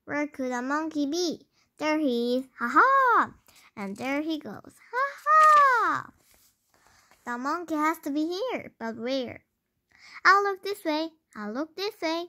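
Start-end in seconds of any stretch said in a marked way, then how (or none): 2.6-3.3 gleeful laugh. Acted. Monkey laughing while escaping. 4.9-6.1 very high shouted laugh; shouted cackle. Acted. Monkey laughing while escaping. 10.2-11.1 high loud first look; higher and louder. Acted. Searching one way then the other.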